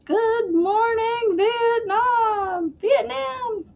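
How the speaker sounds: high, slow, drawn-out radio call; Good Morning Vietnam impression. Fan quoting Robin Williams's DJ line, high and drawn out. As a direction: stretch it out like a DJ calling the troops. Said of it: "I love Robin Williams".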